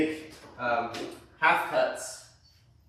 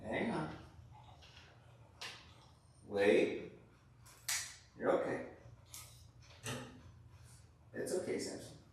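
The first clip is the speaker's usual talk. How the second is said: soothing dog; 3 semitones lower, 13 dB quieter than usual. Real, not acted. Calming wiggling dog on arm.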